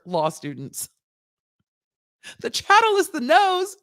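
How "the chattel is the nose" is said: much higher, giddy reenactment; giddy squeal. Retold or imitated. Host replaying the law school joke, giddy high squeal. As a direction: squeal the punchline again.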